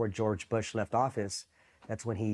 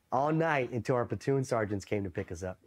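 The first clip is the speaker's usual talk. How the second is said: normal talking voice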